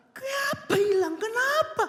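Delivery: high, slow, hammed-up wail; exaggerated high-pitched outrage; mock angry protest. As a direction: act out someone raging at a loss.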